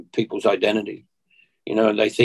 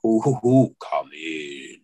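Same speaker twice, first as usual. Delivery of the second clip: spooky haunted-house voice; 7 semitones lower, slower than usual. Retold or imitated. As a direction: moan it like a horror movie ghost at the castle door. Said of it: "I was so scared".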